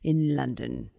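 spooky storyteller. Acted. Podcaster setting the scene of a London murder, spooky storyteller. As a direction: draw out the murder tale with hammy suspense.

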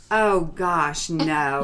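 loud, drawn-out protest, dropping in pitch; retold dismay. Recalling her reaction to reading Loving What Is, dismayed. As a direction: groan it out loudly in mock dismay.

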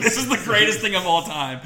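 gleeful laugh-talk at the runaway horse; laughing delight. Retold or imitated. Retelling his reaction with a laugh. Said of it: "And I'm like".

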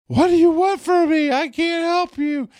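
very high, loud anguished shout; yelling at son. Retold or imitated. Father to crying son in the back seat, desperate shout. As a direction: shout in exasperation, voice cracking up high. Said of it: "I yell at him".

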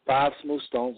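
winded, out of breath from talking nonstop. Real, not acted. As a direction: speak out of breath after a long run of talk. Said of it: "I'm out of breath".

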